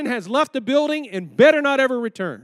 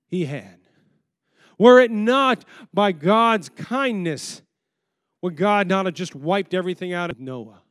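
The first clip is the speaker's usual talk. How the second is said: ordinary talk, nothing marked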